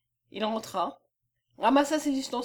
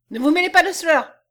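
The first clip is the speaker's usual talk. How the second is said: raised, angry cry, higher and louder; furious shout; 6 semitones higher, 10 dB louder than usual. Acted. Furious husband to his wife, telling her to stay out, shouting.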